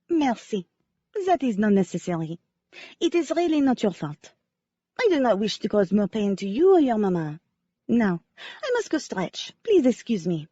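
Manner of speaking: lightly voiced French ballerina, sincere; a hint of French accent, remembering gratefully. Ballerina Cece telling the amateur sleuth how Wynne saved her, light French accent. Cece's voice continues.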